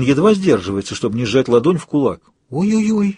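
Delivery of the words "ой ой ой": high frightened ой-ой-ой; a scared little cry, pitched high. Acted. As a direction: yelp it in sudden fright. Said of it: "испугалась Мэл".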